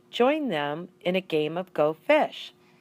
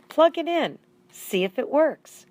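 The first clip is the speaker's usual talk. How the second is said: high, loud "plug it in"; wife's urging; 10 semitones higher, 5 dB louder than usual. A narrator's reading. Wife tells husband to plug in the TV, loud and eager. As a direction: push him to test it, eager. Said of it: "his wife told him".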